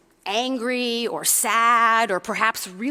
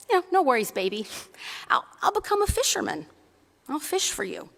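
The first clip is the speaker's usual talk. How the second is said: easygoing mother bunny; 6 semitones higher, faster than usual. Acted. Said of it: "And Mother Bunny says".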